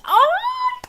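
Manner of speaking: very high "oh" squeal; high-pitched swooning squeak; adoring squeal. Real, not acted. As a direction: squeal as if melting with love. Gushing over the newlyweds.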